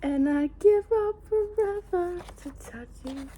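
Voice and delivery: high, slow, awestruck gush; gushing as if in love with it; breathless awe. Real, not acted. Gushing over the illustration.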